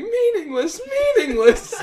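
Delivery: high, loud hammed-up cry; mock screaming. Retelling his outburst in the gym, mock screaming. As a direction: yell it out high, half laughing. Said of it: "I just start screaming".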